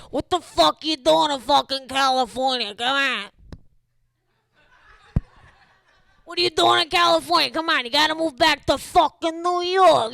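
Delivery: exaggerated New York accent; New York family accent. New York family nags him to move back, thick New York accent.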